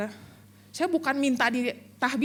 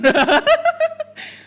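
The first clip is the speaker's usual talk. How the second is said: loud shrieking laugh, much higher; 14 semitones higher, 10 dB louder than usual. Real, not acted. Pastor laughing at Dwi's math, high shrieking laugh. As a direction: burst into a high squealing laugh. Laugh after teasing Dwi.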